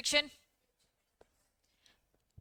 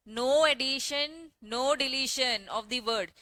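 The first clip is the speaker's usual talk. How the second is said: slow dictation; much slower than usual. Shown as a demonstration. Teacher dictating the rule slowly.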